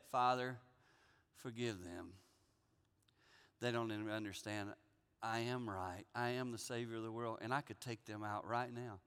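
low, soft, restrained Jesus voice; quiet forgiveness. Acted. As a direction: speak low and softly, holding power back.

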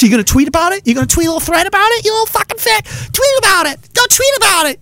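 very high, sneering mock voice; high-pitched derisive needling; jeering taunt. Real, not acted. Taunting Kurt about tweeting.

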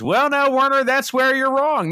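imitated movie tough guy, pitched very high and loud; Brad Pitt. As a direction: crow it in a loud, high mock character voice. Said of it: "And then Brad Pitt says".

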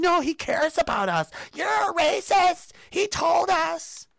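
high slow mock sheep whine; brainwashed sheep. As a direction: play a dim sheep defending the wolf. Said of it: "other sheep are going".

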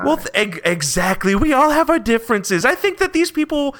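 high-voiced, over-friendly official; Barnabas. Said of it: "Barnabas, uh, notices you say this, and he's like".

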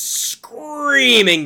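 "screaming" stretched out, high and loud; drawn-out emphatic SCREAMING. Shown as a demonstration. Fan praises hot-blooded attack calls, "screaming" drawn out in a high yell. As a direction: yell the word "screaming" as a long swoop. Acts out the screaming being described.

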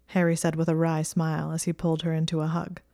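plain reading voice

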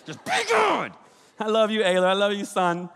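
put-on high, strained hush to a screaming child; exasperated parent. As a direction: snap then force it sweet. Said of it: "you're like".

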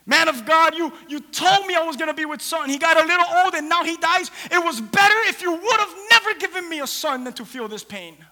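acted anguished outcry, high and loud; screaming in pain. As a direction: cry out at the one who let you down. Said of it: "she was screaming at him".

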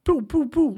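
a gamer imitating the pulse cannon's sound; mock pulse cannon blasts. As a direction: play a toy laser gun. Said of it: "pulse cannon blast, like".

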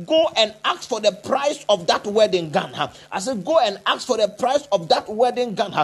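usual speaking voice

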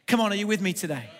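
ordinary talk

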